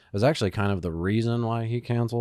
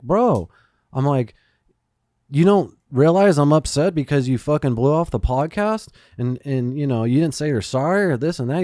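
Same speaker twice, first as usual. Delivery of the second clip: retold angry telling-off; 5 semitones higher, 7 dB louder than usual. Retold confronting friend about podcast.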